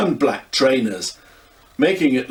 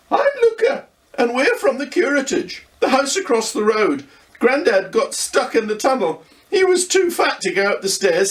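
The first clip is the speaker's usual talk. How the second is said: put-on higher, lively young boy voice; boy Luca; 11 semitones higher than usual. A narrator's reading. Luca teases grandad about looking scary, young boy voice. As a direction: play a cheeky young boy teasing grandad.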